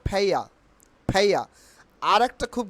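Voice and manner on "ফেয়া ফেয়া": "ফেয়া" with aspirated p, no final r; corrected pronunciation. Shown as a demonstration. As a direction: puff the p and drop the r in "ফেয়া". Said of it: "কি উচ্চারণ হবে?". ("ফেয়া" মহাপ্রাণ ফ, র ছাড়া)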